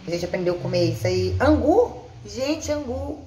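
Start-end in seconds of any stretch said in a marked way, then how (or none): 1.4-3.2 surprised. Real, not acted. Amazed at new food.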